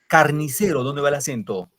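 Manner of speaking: normal talking voice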